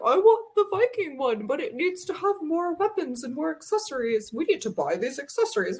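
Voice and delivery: mock child demand, easing off at the end; Dante. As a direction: insist like a small kid with a big voice. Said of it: "the voice I use for him".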